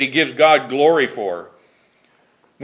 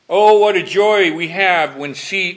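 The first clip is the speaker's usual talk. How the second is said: normal talking voice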